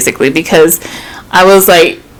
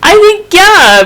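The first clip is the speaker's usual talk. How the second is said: tearful, much higher voice, laughing through tears; getting emotional; 11 semitones higher than usual. Real, not acted. Telling her friend about the pregnancy scare, tearful and laughing. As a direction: choke up, laugh and cry at once. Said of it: "I'm getting emotional again".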